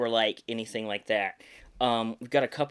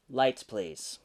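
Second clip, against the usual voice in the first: solemn, louder storyteller read; dramatic stage-direction reading; 7 dB louder than usual. Acted. Actor reading his audition script to viewers, dramatic narration. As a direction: read the direction like a trailer voiceover.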